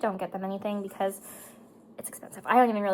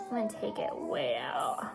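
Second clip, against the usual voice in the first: low whisper to herself; 10 semitones lower, 6 dB quieter than usual. Real, not acted. To herself while blending the shadow, whispered low. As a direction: breathe the words, lower, unvoiced. Muttering to self while applying.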